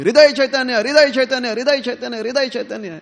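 loud cry that fades off with each repeat; shouting the guru's name. Acted. Shyamanand Prabhu cries out to his guru, loud then fading. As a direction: call the name out loud, then let it die down. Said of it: "starts shouting out loudly".

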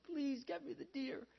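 high-pitched acted plea; begging. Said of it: "she begged Ram".

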